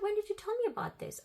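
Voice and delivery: very high-pitched, baffled demand; retold incredulous challenge. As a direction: act out exasperated disbelief. Retold challenge, feeling she's going crazy.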